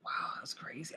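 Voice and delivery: breathy stunned whisper; whispered awe. Real, not acted. Vlogger stunned by the quiz result, awed whisper. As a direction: say it under your breath, amazed.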